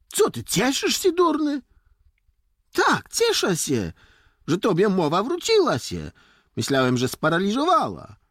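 high, loud teasing retort; taunting village boy. Acted. Boy mocks friend whose speech came back, taunting. Boys quarrel and tease each other.

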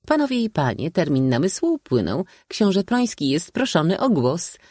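high, ringing formal announcement; orating old maid. A narrator's reading. Old maid calling the prince to speak, orating. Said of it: "perorowała dalej".

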